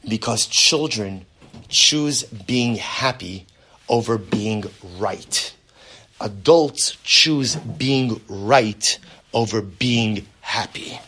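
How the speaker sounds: slow, deliberate quoting of the teaching; reverent, slow recitation of a teaching; slow quoted wisdom. Acted. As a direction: lay out each word of the sage's line slowly. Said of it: "He says".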